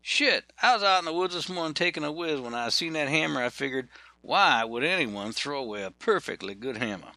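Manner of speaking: put-on high, fast Southern good old boy; a puzzled Georgia worker blurting it out. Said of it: "Rudy said".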